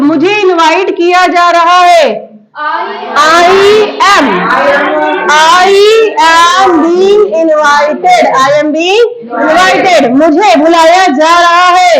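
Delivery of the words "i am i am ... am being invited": drawn out, word by word; very slow dictation. Shown as a demonstration. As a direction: stretch the sentence for pupils. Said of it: "teacher dictating for class".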